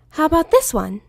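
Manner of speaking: high, bubbly pony voice; lightly high cheerful Pinkie; Pinkie Pie. Acted. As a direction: chirp it like an eager pony.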